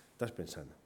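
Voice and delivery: a quiet, sinking afterthought; hushed repeat. Real, not acted. Preacher telling listeners their mind keeps going, hushed. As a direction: drop low and very soft.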